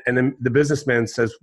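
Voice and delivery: ordinary talk, nothing marked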